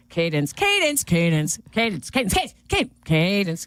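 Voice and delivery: un padre llamando a la hija, repitiendo Cadence; calling Cadence over and over, lightly voiced; mock parent calling. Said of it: "you go".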